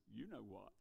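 low, hushed, knowing delivery; soft, low and pointed; sly parrot punchline. Acted. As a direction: drop it down quiet for the punchline. Parrot's sly punchline after scolding.

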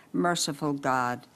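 ordinary talk

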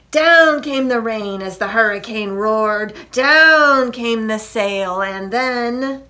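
loud, weighty hit on each "Down"; dramatic story narration. A narrator's reading. Reader tells of the hurricane striking, dramatic narration. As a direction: build suspense, leave it hanging. Reads a picture book.

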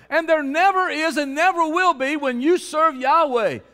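loud, high-pitched preaching at full force; full-throated pulpit shout; impassioned preaching. Real, not acted.

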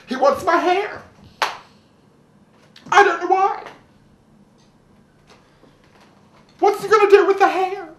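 high, loud whiny wail; distraught whining. Acted. Wronged wife wailing about her husband, high whiny complaint.

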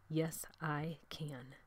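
very slow, soft whisper; defiant whisper. A narrator's reading. Jonathan answering Sebastian's doubt, slow defiant whisper. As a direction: whisper it slowly, word by word.

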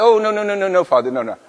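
panicked refusal. Retold or imitated. Panicked boy refusing to serve.

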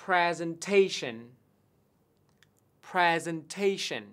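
"presentation" slow, pre lightly stressed, TA main; secondary stress on pre. Shown as a demonstration. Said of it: "trọng âm phụ, rơi vào từ pre". (đọc chậm "presentation", nhấn phụ vào "pre")

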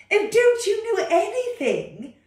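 very high, loud, exasperated cat voice. Acted. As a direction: scold the frog like an exasperated know-it-all.

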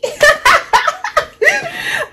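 very high squealing laugh; giddy shrieking laughter; high giggle. Real, not acted.

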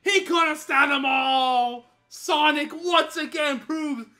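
slow, loud hype announcer; announcer commentary. Acted. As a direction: call the finish like a sportscaster.

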